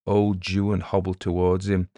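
ordinary delivery

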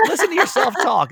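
very high, hammed-up instruction; mock advice. Radio host mimicking self-talk advice, mock insistent.

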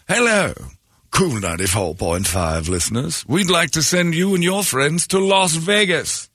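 mock British radio announcer; hammed-up posh British ad read, slow; imitating a British DJ; British accent. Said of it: "he does this British accent".

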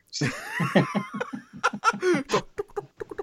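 a long high laugh; laughing hard at the pun. Real, not acted. Joke about rock band.

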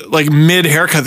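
ordinary delivery

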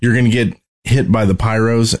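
normal talking voice

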